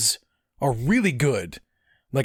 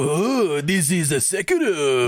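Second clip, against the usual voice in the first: exaggerated bad-dub imitation; mocking imitation; 3 semitones higher, slower than usual. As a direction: parody your friend's dubbed warrior voice. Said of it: "they don't go".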